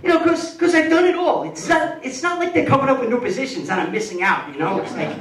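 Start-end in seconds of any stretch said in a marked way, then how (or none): 0.0-3.2 worked up. Real, not acted. Excited rant.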